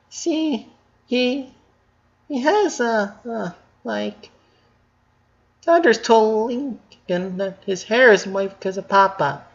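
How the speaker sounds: put-on lightly stumbling high girl voice; high girlish voice. A narrator's reading.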